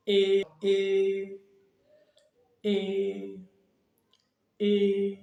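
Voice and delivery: "E" said as long, level "ee"; first tone. Shown as a demonstration. Teacher drills the next vowel, "E" long and level. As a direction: sustain each "E" as "ee" without rise or fall. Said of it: "avec la voyelle E".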